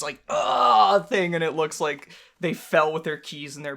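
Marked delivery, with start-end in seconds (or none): 0.3-1.0 mock knockout groan. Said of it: "then they do this".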